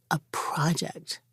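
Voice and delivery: soft unvoiced whisper; hushed and slow. Real, not acted. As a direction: drop to a whisper for emphasis. Hushed aside in reflective talk.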